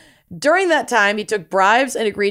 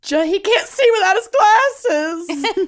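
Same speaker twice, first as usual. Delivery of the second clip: higher, slow Southern drawl on the line; southern accent; 11 semitones higher than usual. Retold or imitated. Co-host quoting the movie kid in a Southern accent. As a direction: play it as a sweet Southern girl. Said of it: "I don't know why I gave her a southern accent".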